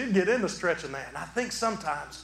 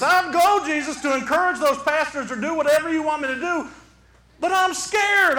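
scared protest; 10 semitones higher, 6 dB louder than usual. Acted. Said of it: "I can imagine saying to Jesus".

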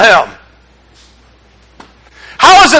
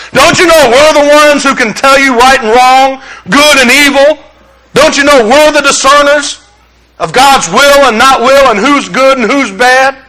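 arrogant church leaders; 3 semitones higher than usual. Retold or imitated. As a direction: puff up like self-important leaders. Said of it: "here's the leaders of the church".

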